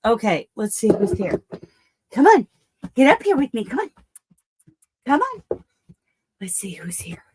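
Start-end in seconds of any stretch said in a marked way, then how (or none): 2.1-5.6 loud high call to the dog; calling the dog onto the bed. Real, not acted. 6.4-7.2 hushed unvoiced aside; quiet whispered check. Real, not acted. Whispering before going live.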